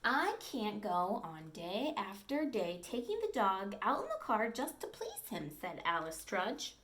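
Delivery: storybook read-aloud, clear and lively; reading to children. Acted. Teacher reading a dog story to the class, lively storytelling. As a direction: read it to the class like a picture book.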